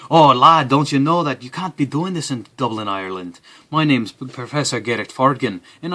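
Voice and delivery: a hammed-up Irish brogue, opening loud on the first call; Irish accent. Shown as a demonstration. Applicant shows off an Irish accent for the camp reviewers. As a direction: put on a stage Irish lilt as a party trick. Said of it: "I can do an accent and I can switch to one on hand".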